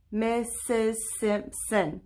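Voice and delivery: "Missus Simpson" slowly in four separate beats; syllable by syllable. Shown as a demonstration. Teacher counting her name's syllables, "Missus Simpson" slow and separated. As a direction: chop "Missus Simpson" into four drawn-out, even parts. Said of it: "see how many syllables are in my name".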